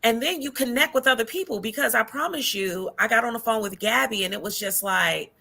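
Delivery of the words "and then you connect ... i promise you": ordinary talk, nothing marked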